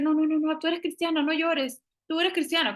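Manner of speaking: hammed-up church friend telling you not to cry; mocking pushy believer. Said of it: "Entonces no podemos decir".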